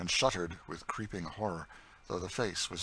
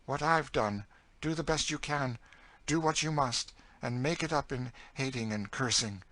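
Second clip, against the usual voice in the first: raised, hard advice; higher, embittered story voice; bitter older captive character; 6 semitones higher, 3 dB louder than usual. Acted. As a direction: lay down grim advice in short phrases. Cassie gives bitter advice.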